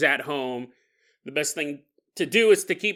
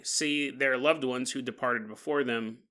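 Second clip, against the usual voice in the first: plain reading voice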